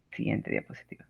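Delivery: quick whisper under the breath; whispered aside. Real, not acted. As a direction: whisper it off-mic. Aside to slide operator.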